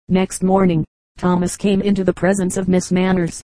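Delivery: ordinary delivery, nothing marked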